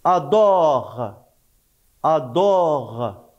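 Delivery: ordinary delivery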